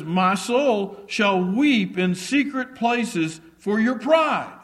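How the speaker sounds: loud, rising proclamation of scripture; preached with full force, climbing at the end; scripture cried out. Acted. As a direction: ring out the prophet's lament. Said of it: "he says".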